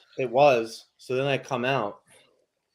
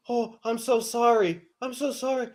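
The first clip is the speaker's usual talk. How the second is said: simper it up high; mock apologetic lady; 10 semitones higher than usual. Imitating the lady backing down with a high fake apology. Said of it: "she's just like".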